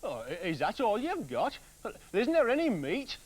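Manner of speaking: lightly voiced grumbling husband; complaining woodcutter. Woodcutter complains to his wife about dinner, lightly whining. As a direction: whine about the thin dinner. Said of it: "complained the man".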